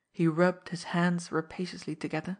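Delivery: plain reading voice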